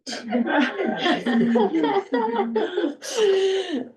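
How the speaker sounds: high, breathless burst of laughter. Real, not acted. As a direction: laugh it off, winded. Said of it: "I'm running out of breath".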